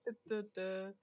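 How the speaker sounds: flat, mechanical robot voice. Retold or imitated. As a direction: speak like a talking machine. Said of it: "it just says words in a robot voice".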